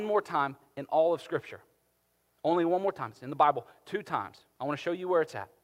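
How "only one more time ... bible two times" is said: normal talking voice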